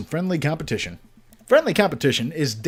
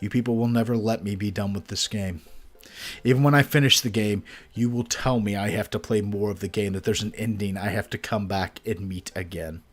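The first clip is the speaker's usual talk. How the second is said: resigned and quiet; 7 semitones lower, 7 dB quieter than usual. Real, not acted. Resigned rant to viewers.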